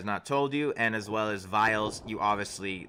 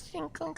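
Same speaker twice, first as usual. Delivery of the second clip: glass clink sound; 7 dB quieter than usual. Acted.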